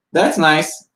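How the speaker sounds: high, curt brush-off; unimpressed little man; dismissive doorman. Acted. Doorman dismissing the prince, high and curt. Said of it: "peeks down and goes".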